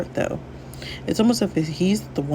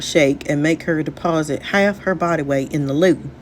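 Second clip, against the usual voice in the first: put-on advice in a Southern accent; Southern-accented character voice. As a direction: play a Southern buddy giving counsel. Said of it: "I also gave him a Southern accent".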